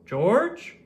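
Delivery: called out high and loud across the waiting room; calling out. Acted. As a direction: call the patient's name up and out. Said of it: "he called".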